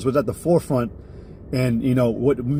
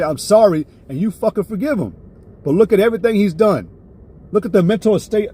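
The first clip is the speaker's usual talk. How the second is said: getting heated; 5 semitones higher than usual. Real, not acted. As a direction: let the anger climb as you preach. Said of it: "Ooh, I'm getting hot. I'm getting mad".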